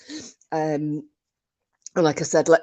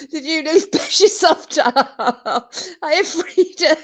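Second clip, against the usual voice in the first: giggling through the words; helpless high-pitched laughter; laughing hard; 12 semitones higher than usual. Real, not acted. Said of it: "because I was laughing that hard".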